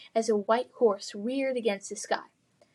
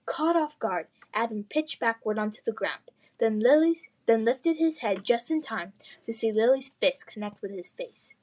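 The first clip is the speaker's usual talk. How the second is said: usual speaking voice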